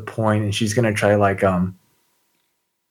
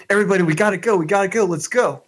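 urgent shout; 9 semitones higher, much faster than usual. Acted. Urging group to flee.

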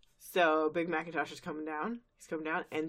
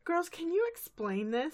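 high, puzzled teacher voice; Miss Cheerly voice; 11 semitones higher than usual. Retold or imitated. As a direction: ask it dazed and confused.

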